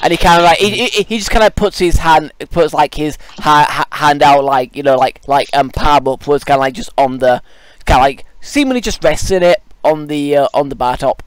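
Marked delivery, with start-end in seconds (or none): none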